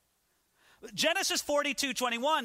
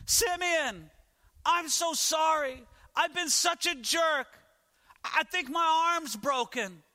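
higher, desperate begging from the pit; pleading Joseph; 7 semitones higher, slower than usual. Acted. Joseph in the pit begging his brothers, high desperate pleading. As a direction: beg your brothers not to leave you, voice raised. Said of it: "Can you hear the voice of Joseph?".

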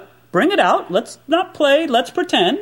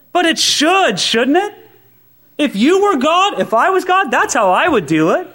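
mock indignant; 8 semitones higher than usual. Real, not acted.

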